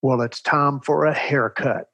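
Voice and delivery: imitating a condescending Texas principal; good-old-boy principal. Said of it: "they talked like this".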